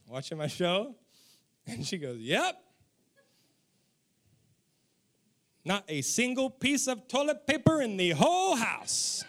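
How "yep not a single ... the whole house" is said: pointed, swooping exasperation, lightly hammed-up; mock angry wife. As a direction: imitate a wife getting angrier at being ignored. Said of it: "somebody getting angrier and angrier".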